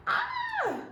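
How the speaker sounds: sudden high squeal of delight; a quick gleeful squeak. Real, not acted. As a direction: let a tiny excited shriek out. Excited squeal at the card.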